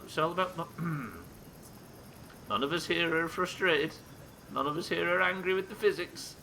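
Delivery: strained, high fake-calm reassurance; forced calm. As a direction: insist everything's fine through frustration. Forcing calm while frustrated in game.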